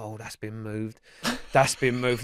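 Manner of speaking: low, quiet self-talk; talking to himself. Retold or imitated. Older man to himself in his garage, quietly imitated. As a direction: imitate someone puzzling something out under their breath. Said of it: "he's talking to himself".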